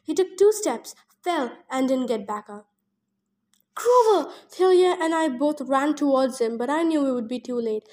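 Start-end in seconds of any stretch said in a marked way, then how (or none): 3.7-4.3 a sharp, high shout of the name; calling out. Acted. Narrator shouting to a fallen friend, loud call. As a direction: cry out to a friend who just fell.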